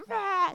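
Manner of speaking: mock furious roar, pitched high; imitated screaming rah. Imitating an angry contractor on the radio, high mock roar. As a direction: bark out a high cartoon roar like an angry boss.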